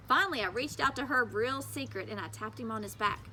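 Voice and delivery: ordinary delivery, nothing marked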